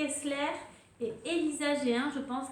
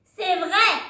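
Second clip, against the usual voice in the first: higher and much louder toy voice; talking cuddly toy; 6 semitones higher, 13 dB louder than usual. Acted. Narrator reading to kids, the talking doudou answers loudly. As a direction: give the toy a bright squeaky voice. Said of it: "répond le doudou qui parle".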